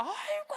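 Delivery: mock disgusted wail. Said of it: "더럽다고 막 난리치면서".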